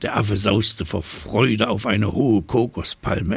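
plain reading voice

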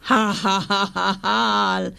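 slow staged evil laugh; monster laugh. A narrator's reading. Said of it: "lachte das Monster".

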